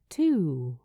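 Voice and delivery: usual speaking voice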